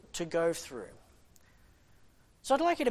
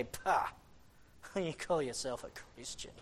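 mocking devil; 5 semitones lower, 7 dB quieter than usual. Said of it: "laugh at him and say".

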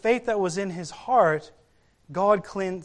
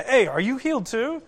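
higher, eager mock question to a neighbour; excited retold question; 5 semitones higher than usual. Healed man nudges the next guy, excited. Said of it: "excited about it".

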